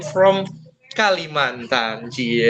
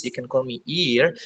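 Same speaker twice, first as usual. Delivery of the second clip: normal talking voice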